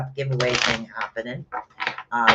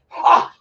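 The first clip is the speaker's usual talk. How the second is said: triumphant ha; 9 dB louder than usual. Acted. After acted Thor cry.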